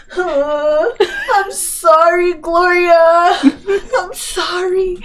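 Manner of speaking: high mock-crying drunk friend; drunk, tearful apology. Drunk friend apologizes to mom Gloria, mock tearful wail. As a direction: wail it out in a hammed-up drunk sob.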